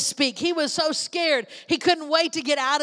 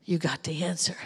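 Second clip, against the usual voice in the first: whispered; 8 semitones lower, 5 dB quieter than usual. Acted. Repeats line softly then builds.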